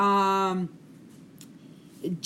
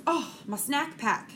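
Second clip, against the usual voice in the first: higher-pitched retold kid voice; quoted young son; 6 semitones higher than usual. Son telling mom about his snack pack, quoted in higher voice. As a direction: voice a son's offhand exclamation, higher. Retelling her young son's words.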